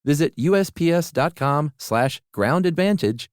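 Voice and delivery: confident commercial announcer voice; ad read. Acted. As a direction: read it like a polished radio spot. Host reads USPS ad.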